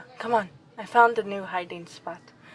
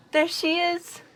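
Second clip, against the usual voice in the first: delighted exclamation; 10 semitones higher than usual. Acted. Spots her friend at party.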